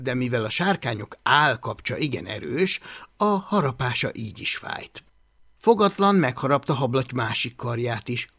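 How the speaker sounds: plain reading voice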